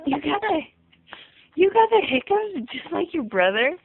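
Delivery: put-on baby talk. Talking to baby.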